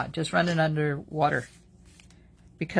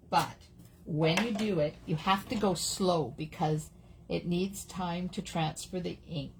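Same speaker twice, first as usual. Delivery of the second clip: normal talking voice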